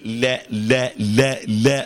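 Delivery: "لا" thin and fronted, with a smile; repeated fine, smiling "لا"; smiling light lam. Shown as a demonstration. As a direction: spread the lips and lighten "لا" to "leh". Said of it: "وتحس بابتسامة كده".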